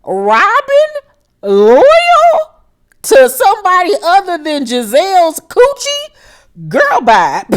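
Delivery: high, incredulous mock voice, stretching each word; mocking disbelief. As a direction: scoff like you can't believe what you just heard. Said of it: "looked at her like".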